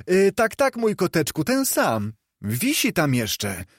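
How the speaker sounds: put-on a street boy puzzled by the question; boy's voice. A narrator's reading. Boy in the street answering Scrooge, lightly voiced.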